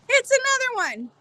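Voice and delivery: high, mock-despairing squeal; exasperated high squeal. Real, not acted. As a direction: whine it, fed up with repeats.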